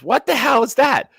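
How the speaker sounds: high, loud retold outburst; startled demand. Friend hit by blaring music on a call, loud high yell. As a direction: imitate a baffled friend yelling down the phone. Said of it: "He goes".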